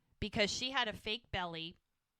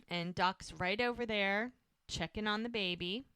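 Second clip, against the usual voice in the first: normal talking voice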